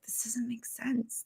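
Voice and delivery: whispered, breath only, slowed down; a baffled whisper to yourself; stunned whisper. Retold or imitated. Stunned thought after failed YouTube talk.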